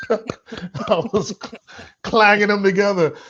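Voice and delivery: high, laughing and excited. Real, not acted. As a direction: giggle it out, still thrilled. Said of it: "I got so excited".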